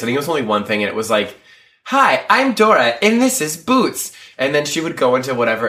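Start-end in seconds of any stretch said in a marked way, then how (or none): 1.8-4.1 put-on high, slow singsong kids-show greeting; Dora the Explorer. Retelling a TV spoof, mock Dora introduction. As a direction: parody a children's cartoon host, pitched way up. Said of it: "a spoof of Dora the Explorer".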